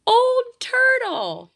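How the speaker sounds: very high, slow, loud announcement; astonished reveal. A narrator's reading. Narrator revealing whose voice stopped the argument, astonished. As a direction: leap up high in amazement, stretching it out.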